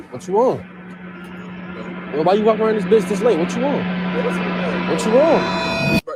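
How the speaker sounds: loud, confronting street tough; tough guy act. Pretend. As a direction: get in their face like a menacing stranger. Prank persona confronting stranger.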